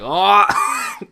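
loud, high retold "oh"; mock shock. Retelling his reaction to the couple behind him, shocked.

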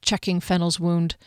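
ordinary delivery, nothing marked

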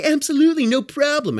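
high, overly sweet fake agreement; mock-cheerful brush-off; sugary sarcastic yes. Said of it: "I was kind of a smart ass".

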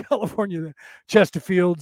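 usual speaking voice

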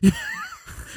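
shrieking burst of laughter. Real, not acted. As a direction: cackle up high. Big laugh at joke.

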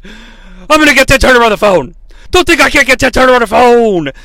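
loud, worked-up wrestling promo; Ric Flair. Acted. Wrestler threatens to call the boss, loud promo rant. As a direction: rant like a wrestler, ending in a whooping yell. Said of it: "excited to hear that Ric Flair".

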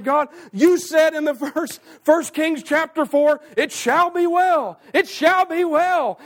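high, loud, slow prayer cry; crying out to God. Acted. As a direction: cry the promise back up to God. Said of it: "are we saying".

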